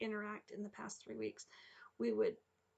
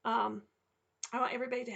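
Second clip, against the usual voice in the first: normal talking voice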